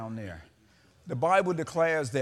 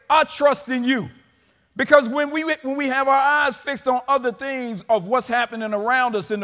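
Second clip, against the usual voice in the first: raised, emphatic sermon voice; forceful preaching; 8 semitones higher, 11 dB louder than usual. Acted. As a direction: preach it out to the church.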